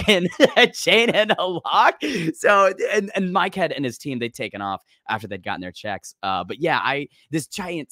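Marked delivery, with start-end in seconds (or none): 0.0-2.0 higher, louder retold alarm breaking into laughter; incredulous protest. Commentator to men heading upstairs with chain, incredulous protest. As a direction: protest in mock horror, a laugh cutting in.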